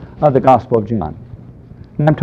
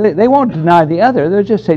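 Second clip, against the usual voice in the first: ordinary talk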